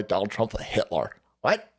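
ordinary talk

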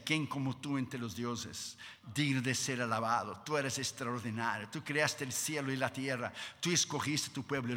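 low, hushed adoring praise; hushed reverent prayer of praise; soft worship. Retold or imitated. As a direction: praise God tenderly under your breath. Moses praising God softly.

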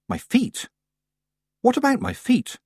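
high, baffled questions; angry bewilderment. A narrator's reading. Tiffany halts over the broomstick, bewildered. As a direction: jump up in disbelief. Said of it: "Tiffany, angry and bewildered".

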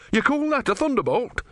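high-pitched scornful outrage; shaking with anger. A narrator's reading. Disciple scorning his god's threat, high-pitched fury. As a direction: scoff angrily, pitched very high. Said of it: "red in the face and shaking".

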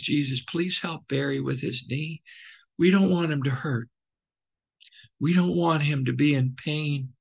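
put-on hushed like little boys praying in the next room; children's quiet prayer. Boys praying for their counselor's sore knee, soft child voice.

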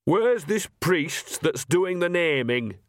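put-on high, slow, indignant old woman; cackly old gossip; snapping old woman. A narrator's reading. Nanny asks.

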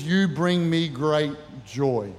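low, slow and gentle, each word given weight; slow tender blessing. Shown as a demonstration. Preacher voicing a dad to his child, slow and tender. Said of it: "And three is".

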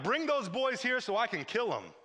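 mock-furious royal command, pitched high; hammed-up anger, high voice; furious king. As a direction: order it like an enraged king. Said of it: "The king is furious at this point".

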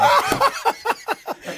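high, breathy burst of laughter. Real, not acted. Host cracking up over Franklin and the kite, high laugh.

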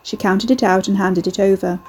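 plain reading voice

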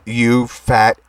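very slow, word by word; dictating. Acted. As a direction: spell it out slowly for writing down. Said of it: "Here's the info".

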